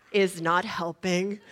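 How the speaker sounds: ordinary delivery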